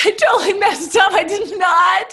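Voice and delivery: high, loud, half-crying cry of dismay; hammed-up tearful shout of regret; mock anguished wail. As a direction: wail it like a guilty mom who blew it. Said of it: "I was like".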